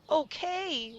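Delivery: high incredulous exclaim; can't believe it. A narrator's reading. Rob incredulous at his cow's answer, exclaiming. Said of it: "Rob exclaimed".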